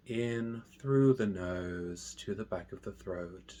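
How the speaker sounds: hushed, unhurried guidance, letting each phrase settle; guided meditation. Real, not acted. Meditation teacher guiding listeners through mindful breathing, slow and soft.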